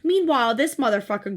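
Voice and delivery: normal talking voice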